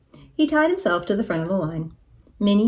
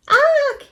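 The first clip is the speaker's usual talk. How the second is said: very high, loud startled squawk; falling rooster's alarmed yelp; cried squawk; 6 dB louder than usual. A narrator's reading. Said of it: "he cried".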